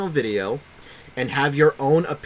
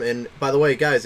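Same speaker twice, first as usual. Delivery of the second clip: usual speaking voice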